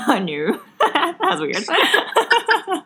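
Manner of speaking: high, slow laugh through the words. Real, not acted. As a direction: laugh it out in disbelief. Laughing at own accent slip.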